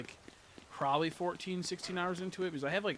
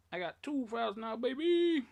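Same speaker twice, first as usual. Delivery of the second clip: higher, louder triumphant boast; excited brag; 9 semitones higher, 5 dB louder than usual. Real, not acted. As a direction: crow it like a win. Bragging about two save files.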